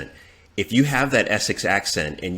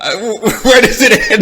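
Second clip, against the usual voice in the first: laughing; 13 semitones higher, 11 dB louder than usual. Real, not acted. Laughing at the drinking remark.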